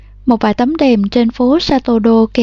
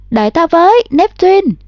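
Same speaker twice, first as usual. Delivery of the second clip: calling after the dog; 7 semitones higher than usual. A narrator's reading. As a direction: shout after the dog as it runs. Girl calls to running dog. (gọi to theo con chó)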